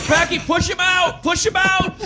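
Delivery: high, urgent yelled command; screaming. Retold or imitated. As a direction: scream at your friend in panic. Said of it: "so I just scream".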